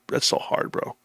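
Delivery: That's so hard whispered without voice. Real, not acted. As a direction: breathe it out as a whisper.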